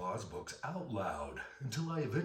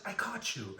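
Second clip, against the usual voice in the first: much higher, gleeful gotcha; gloating little sister; 11 semitones higher, 4 dB louder, slower than usual. Retold or imitated. Little sister catching him with candy, gleeful high gotcha. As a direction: squeal it like a triumphant kid. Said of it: "she says".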